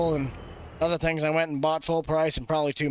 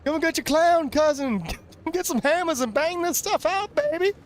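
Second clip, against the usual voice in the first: much higher, slow playful call; high put-on call; 11 semitones higher than usual. Calling the clown cousin over, high and slow. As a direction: sing out a slow high invite.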